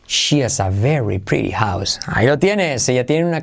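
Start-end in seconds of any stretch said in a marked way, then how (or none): 0.1-2.0 slow, lower model sentence; repeat after me. Shown as a demonstration. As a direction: drill it once more, calmly. (repetición conmigo)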